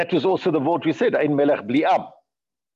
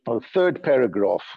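usual speaking voice

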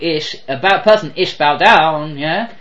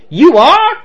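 higher, louder southern "You are?"; delighted disbelief in a southern accent; surprised southern accent; 10 semitones higher, 7 dB louder than usual. Retold or imitated. Operator hearing he's the grandson, amazed southern voice.

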